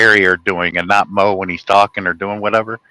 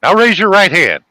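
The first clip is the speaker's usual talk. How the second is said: higher, firm put-on command; court officer impression; 7 semitones higher, 4 dB louder than usual. Court officer swearing in a witness, imitated in a higher voice. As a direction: order it out in a raised voice.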